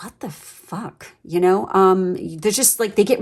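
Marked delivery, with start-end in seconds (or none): 0.0-1.1 slow, much softer, baffled under the breath; muttered disbelief. Retold or imitated. Viewer baffled by a pushy suitor, muttering slowly in disbelief. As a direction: let it slip out quietly, stunned. Reacting to a dominant suitor's pushiness.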